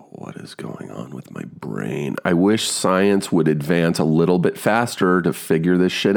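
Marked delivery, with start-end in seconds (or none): 0.1-2.2 much softer and lower, slow weary question; quiet despair. Real, not acted. Fifty-year-old fearing memory loss, quietly despairing. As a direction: mutter it to yourself, worn out.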